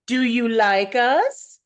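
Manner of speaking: slow, sly devilish coaxing; tempting imp voice. Acted. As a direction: tease like a wicked imp. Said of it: "each laughing diabolically enter".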